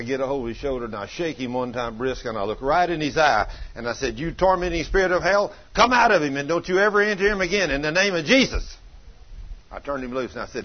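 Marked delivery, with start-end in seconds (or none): 4.2-8.8 loud, rising command to the spirit; commanding rebuke. Retold or imitated. Preacher ordering the tormenting spirit out of the child, loud commanding shout. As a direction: bark it out like an exorcist.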